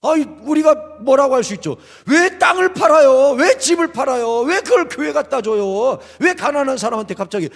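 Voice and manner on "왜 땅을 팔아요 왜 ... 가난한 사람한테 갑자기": acted-out disbelief, strongest at the start; mock protest. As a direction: wail the complaint like a dismayed bystander. Voices protest at impulsive giving.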